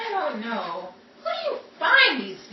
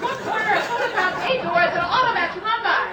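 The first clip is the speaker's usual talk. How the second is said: higher, louder, hurried rush to flee; urgent escape; 8 semitones higher, 6 dB louder than usual. Acted. Betty urging Rachel to run from the raid, excited. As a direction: get her moving fast, excited.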